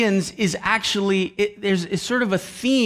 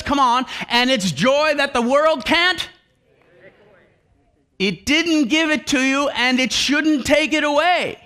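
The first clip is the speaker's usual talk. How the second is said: raised, higher preacher voice; preaching fervour; 4 semitones higher than usual. Acted. Preacher urging the congregation about joy, fervent. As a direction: build the conviction.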